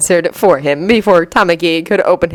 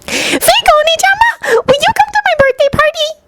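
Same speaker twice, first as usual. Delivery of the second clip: put-on sugary small child asking; sweet child voice. A narrator's reading. Kirimi inviting Tamaki, very high sweet child voice. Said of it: "she asked with a very sweet smile".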